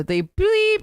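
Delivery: high-pitched mock censor beep; comic self-censoring, high on the bleep; spoken censor bleep. Censoring gruesome explanation with bleeps.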